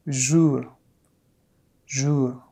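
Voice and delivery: jour with a faint French throat r at the end; subtle final r. Shown as a demonstration. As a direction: let the r in jour barely sound. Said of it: "it's more subtle".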